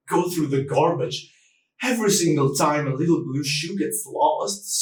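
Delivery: normal talking voice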